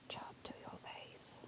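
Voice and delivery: breathy slow whisper; creepy whispered threat. Shown as a demonstration. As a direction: hush it right into their ear. Same line repeated as whisper.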